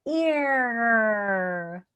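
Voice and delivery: long "ear", gliding from ee into a held r; smile, then pull the tongue back through "ear"; stretched ear sound. Shown as a demonstration. Coach drilling the ear sound, stretched "ear". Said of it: "working on the ear sound".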